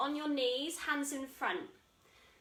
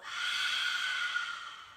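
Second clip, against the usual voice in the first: long breathy sigh out for the class; audible out-breath sigh. Shown as a demonstration. Teacher demonstrating the out-breath, breathy sigh. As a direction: let the air out with a big sigh. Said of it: "And breathe out".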